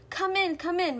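calling out. A narrator's reading. Mother Bear to her children as winter comes, calling out. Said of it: "called to her children".

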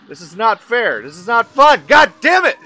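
loud protest climbing to a high yell; escalating rage. Real, not acted. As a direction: build from angry complaint to full shout. Rage builds at unfair game.

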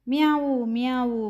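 high drawn-out kitten meow, falling away; kitten's crying mew. A narrator's reading. Teacher reads a crying kitten's mews to pupils, high kitten cry. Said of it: "കുഞ്ഞിപ്പൂച്ചയുടെ കരച്ചിലല്ലേ കേൾക്കുന്നത്".

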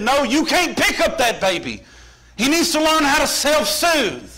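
high-pitched mock telling-off; scolding mama. Mama forbidding papa to pick up the baby, scolding. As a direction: lay down the law, high and emphatic.

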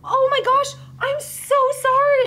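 high, loud, horrified apology; retold frantic apology. Coworker running after the sobbing girl, frantically apologising. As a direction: stretch it out in horrified remorse.

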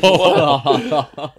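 a laughing, baffled "What?". Retold or imitated. Baffled by the mustard dress, laughing. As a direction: laugh through the disbelief.

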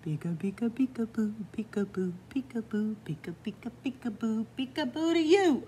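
sing-song peekaboo, bouncing up; playful peekaboo. Acted. Reader playing peekaboo with the little girl, sing-song. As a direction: tease a little one with peekaboo.

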